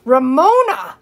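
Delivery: loud, scandalized cry of the name; shocked gasp. A narrator's reading. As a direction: exclaim it, aghast at her rudeness. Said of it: "Missus Wisser gasped".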